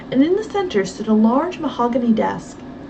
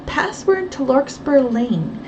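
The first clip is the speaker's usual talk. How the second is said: usual speaking voice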